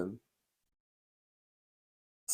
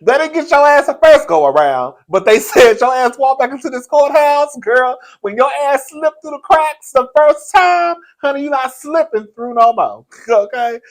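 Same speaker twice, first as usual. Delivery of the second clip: loud, high-pitched telling-off with laughs; comic scolding; 10 semitones higher, 10 dB louder than usual. Real, not acted. She tells O J off over court, comic scolding. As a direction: lay into him at full volume, amused.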